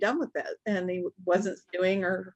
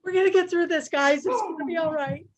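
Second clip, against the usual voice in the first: mock rallying; 8 semitones higher, 4 dB louder than usual. Mom rallying family, mock.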